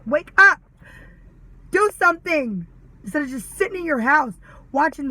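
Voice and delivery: angry shout. Retold or imitated. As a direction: shout it at sleeping people. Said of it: "I'm like".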